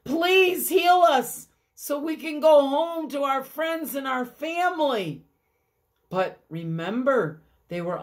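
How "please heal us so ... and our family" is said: high begging plea; pleading. Acted. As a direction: beg to go home. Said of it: "they begin to cry".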